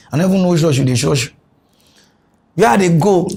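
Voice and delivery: normal talking voice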